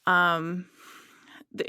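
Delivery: normal talking voice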